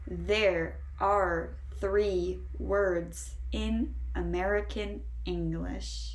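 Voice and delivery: very slow, each word set apart; slow model sentence. Shown as a demonstration. Teacher saying it so all can repeat together, very slowly. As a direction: say it word by word for learners to follow. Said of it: "listen to me say it".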